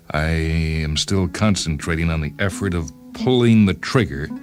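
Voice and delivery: usual speaking voice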